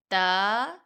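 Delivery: ordinary talk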